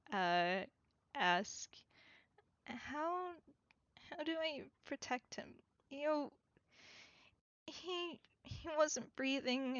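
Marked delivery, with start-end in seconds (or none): 2.7-9.8 put-on small, soft, higher voice of a frightened little boy; small child voice. Little boy asks how to protect him, in a small voice. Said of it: "a very small voice".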